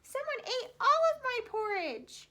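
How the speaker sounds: high, small, loud little bear voice; tiny Baby Bear. A narrator's reading. Little bear cries to his family about his porridge, high and small. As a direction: squeak it out like a tiny cub. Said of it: "And Baby Bear said".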